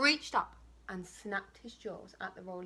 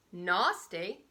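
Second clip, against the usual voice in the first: a touch of indignant surprise; offended cry; 3 semitones lower, 6 dB louder than usual. Acted. Crocodile mock-offended by the bird, mild cry. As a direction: bark it back as if insulted. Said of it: "cried the crocodile".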